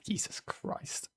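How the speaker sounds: slow disbelieving whisper. Real, not acted. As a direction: breathe it out in disbelief.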